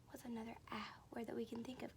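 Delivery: fast hushed question; rapid soft whisper. Shown as a demonstration. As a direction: whisper it quick to the kids.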